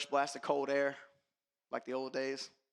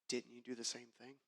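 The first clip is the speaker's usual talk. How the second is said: low, soft voice of conscience; quiet inner voice; 7 semitones lower, 12 dB quieter than usual. Retold or imitated. His conscience quietly confronting him, low and soft. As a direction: let it come as a hushed accusation.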